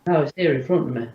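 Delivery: stuffy, blocked-nose voice. Real, not acted. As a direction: talk through a bunged-up nose. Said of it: "my nose is bunging up again".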